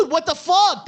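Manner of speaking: high, loud shout of disbelief; shocked outburst. Retold or imitated. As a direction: yell it in stunned disbelief. Acts out own shocked reaction.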